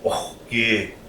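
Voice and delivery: "oki" with a throaty kh before "ki"; inflected pronunciation. Shown as a demonstration. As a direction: rasp a kh after the O in "oki". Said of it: "We're gonna say oki".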